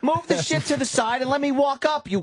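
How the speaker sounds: high-pitched, fed-up outburst; comic high-strung fury; exasperated rant. Acted. Acted rant at slow shopper.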